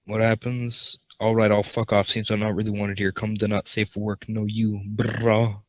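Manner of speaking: ordinary delivery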